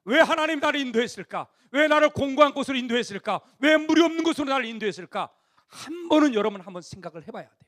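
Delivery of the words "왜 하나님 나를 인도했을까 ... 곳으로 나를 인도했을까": much higher, loud pleading preacher's cry; impassioned questions. Acted. Preacher urging young people to ask God why, impassioned.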